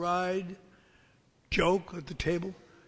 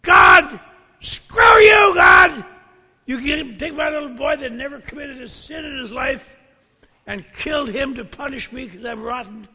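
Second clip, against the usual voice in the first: furious shrieking, much higher and louder; shrieking at God; 6 semitones higher, 16 dB louder than usual. Retold or imitated. Grieving father shrieking at God for taking his son, furious shriek. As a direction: scream it up at God, raging at the loss. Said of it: "I remember just shrieking".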